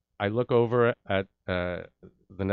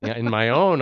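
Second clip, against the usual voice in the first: ordinary talk, nothing marked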